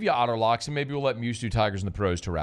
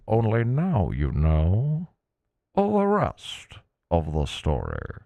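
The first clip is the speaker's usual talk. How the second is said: put-on slow, grand old-time radio voice; 1950s narrator; 4 dB louder, slower than usual. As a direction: stretch each word like a vintage broadcaster. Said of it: "I love the old time radio voice".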